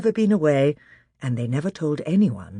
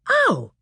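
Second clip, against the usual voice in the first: sharp high yelp of pain; startled ow; 5 dB louder than usual. Acted. Edmund yelps when yanked by magic. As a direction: yelp as if stung. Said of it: "made a noise like".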